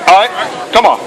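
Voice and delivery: normal talking voice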